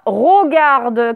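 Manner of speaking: "regarde" said as "rogarde"; mispronounced first vowel. Shown as a demonstration. Teacher warns pupils against a wrong form, mispronounced "rogarde". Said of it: "sauf que ce n'est pas".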